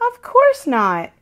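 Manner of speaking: put-on a child quickly reassuring her grandmother; slow, firm of course not. A narrator's reading. Grace hugs and reassures Nana.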